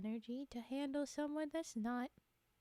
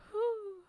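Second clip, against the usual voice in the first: loud relieved whew; 10 semitones higher, 6 dB louder than usual. Real, not acted. Exhale after laughing hard.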